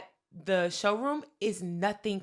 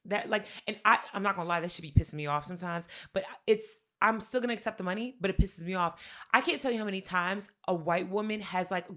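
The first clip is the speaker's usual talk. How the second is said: ordinary talk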